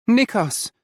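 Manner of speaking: high, loud plea; pleading. A narrator's reading. Ollie pleading with Nikos to breathe. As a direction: call him back to the present. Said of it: "Ollie pleads".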